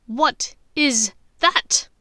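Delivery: icy demand, slow; cold. Acted. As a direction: ask coldly, drawing it out. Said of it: "Bellatrix asked coldly".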